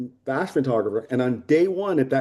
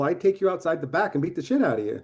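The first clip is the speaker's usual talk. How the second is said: normal talking voice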